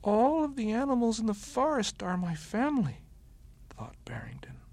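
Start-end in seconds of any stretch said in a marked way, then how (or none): 0.0-3.0 high bunny voice, dawning joy; wondering bunny. A narrator's reading. Bunny repeating the wolf's words to himself, high and wondering. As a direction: say it as a happy realisation.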